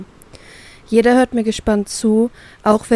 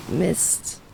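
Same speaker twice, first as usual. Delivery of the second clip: muttered under the breath, much softer; quietly; 4 semitones lower, 10 dB quieter than usual. Acted. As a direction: curse to yourself. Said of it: "spreche ich leise".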